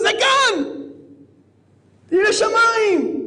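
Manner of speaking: slow, high, booming praise; hailing shout. Real, not acted. Rabbi proclaiming the mohel's greatness in a slow high shout. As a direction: call it out slow to the whole hall.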